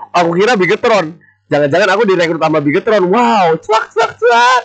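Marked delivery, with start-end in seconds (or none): 3.1-4.6 sudden excited yelp, rising high; excited shout. Real, not acted. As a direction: shout it out in disbelief. Excited at being recruited. (teriakan girang spontan)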